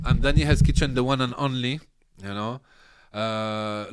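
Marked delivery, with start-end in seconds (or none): 0.0-1.8 louder self-introduction; louder, closer to the mic. Real, not acted. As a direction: say your name again close to the mic. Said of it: "put the mic a little bit in front".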